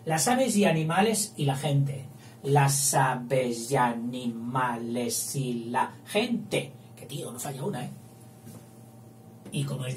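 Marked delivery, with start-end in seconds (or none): 2.4-6.7 slow metrical reading of the verse; slow scansion. Shown as a demonstration. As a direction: stretch the line to count its syllables. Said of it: "pasamos a la B mayúscula".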